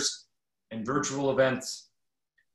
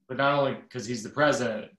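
ordinary talk, nothing marked

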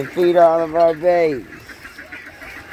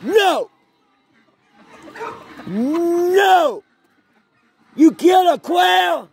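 shouting; 11 semitones higher, much slower than usual. Real, not acted. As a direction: yell in shock. Catches the dog with a quail.

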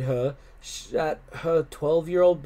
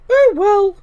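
high, loud grand sigh; haughty cat voice; 14 dB louder than usual. A narrator's reading. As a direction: sniff it out with dignity. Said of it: "said Thelma".

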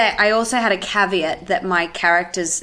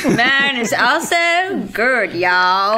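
loud-ish, drawn-out American twang; slow caricature of an American accent, pitched up; put-on American accent; 3 semitones higher, 3 dB louder, much slower than usual. Shown as a demonstration. Australian joining the accent demo, hammed-up American accent. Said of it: "American accent?".

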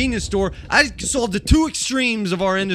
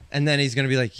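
normal talking voice

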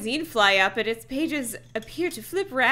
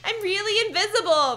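put-on high, excited girl's voice; excited exclamation; 11 semitones higher than usual. A narrator's reading. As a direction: exclaim with giddy delight. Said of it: "we heard her exclaim excitedly".

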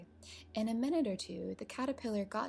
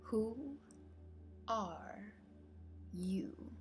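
drawn out with long pauses; drowsy, unhurried question; languid, sleepy; 2 semitones higher, 4 dB quieter, much slower than usual. A narrator's reading. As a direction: let each word drift out lazily. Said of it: "in a languid, sleepy voice".

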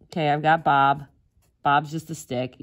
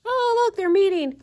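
very high-pitched mock-excited squeal; 3 dB louder than usual. Narrating her comic characters' meeting, high excited squeal. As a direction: play tiny cartoon onlookers, pitched way up.

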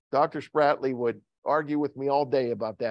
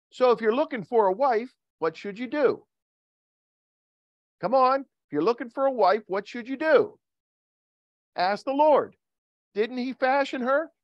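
high, insistent call-out questions; rousing preaching; 9 semitones higher than usual. Real, not acted. Preacher asking men seeking a wife, high rousing exhortation. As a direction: exhort the crowd, pitched well up.

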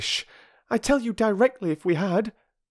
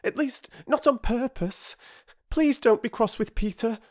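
high, anxious, apologetic schoolgirl; pleading girl, out of breath; breathless girl. A narrator's reading. Bobby begging the bargee not to be cross, high and breathless.

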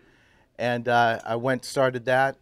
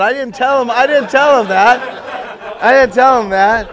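laughing; 11 semitones higher, 13 dB louder, slower than usual. Real, not acted. Joking about a friend in the audience.

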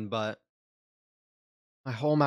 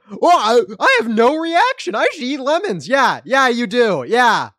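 loud sarcasm; 6 semitones higher, 10 dB louder than usual. Real, not acted. Sarcastic rant at chat.

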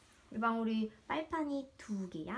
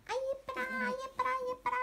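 cooing; 11 semitones higher, 4 dB quieter than usual. Real, not acted. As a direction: coo at the puppy. Cooing over dog. (강아지에게 높은 목소리로 애교 부리듯)

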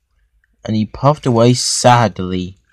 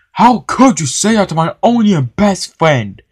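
loud, high indignant protest; angry; 7 semitones higher, 5 dB louder than usual. A narrator's reading. Henry confronts the manager over his best friend, angry. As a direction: stand up angrily for a best friend. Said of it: "Henry was angry".

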